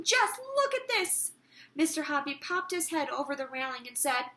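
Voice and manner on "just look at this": high, thrilled exclamation; shrill excitement. Acted. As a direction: show him the wonder, bursting with excitement. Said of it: "shrill with excitement".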